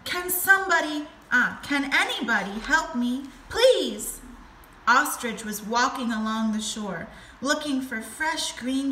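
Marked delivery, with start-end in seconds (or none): 0.0-4.2 louder, higher pleading cry; calling out. A narrator's reading. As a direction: beg anyone for help, hammed up. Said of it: "he called out".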